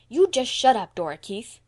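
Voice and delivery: high, loud angry outburst falling on the name; fierce. A narrator's reading. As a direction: snap fiercely at a nagging child. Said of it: "turned fiercely".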